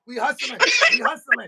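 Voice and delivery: high laughing repeat. Acted. Boyfriend laughing it off to his girl. Playing it off to girlfriend.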